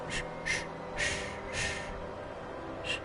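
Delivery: soft whispered shushing. Real, not acted. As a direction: hush it gently. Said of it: "I had to whisper".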